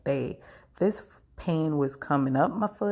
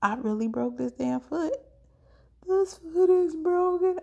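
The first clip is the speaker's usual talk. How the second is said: dawning disbelief; 11 semitones higher than usual. Retold or imitated. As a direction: let the shock build until it cracks. Realising the foot is broken.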